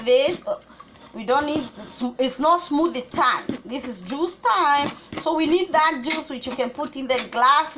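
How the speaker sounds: ordinary talk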